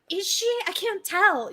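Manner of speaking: high, wondering self-quote; high-pitched mock uncertainty; acted puzzlement. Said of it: "I'm like, like, I'm like".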